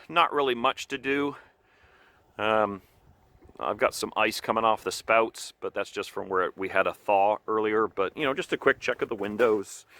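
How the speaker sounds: ordinary delivery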